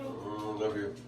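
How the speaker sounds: gentle hushed bedtime voice. Real, not acted. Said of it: "Still doing that dad thing".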